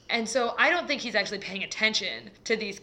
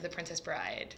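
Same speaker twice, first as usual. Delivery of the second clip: softer whispered title; 5 semitones lower, 10 dB quieter than usual. Real, not acted. Whispered title at sentence end.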